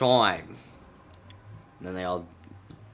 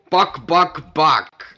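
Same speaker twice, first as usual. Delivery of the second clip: higher, much louder barking; mock American dog bark; 5 semitones higher, 9 dB louder, much slower than usual. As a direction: bark it like a silly loud dog. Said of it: "all the dogs in America go".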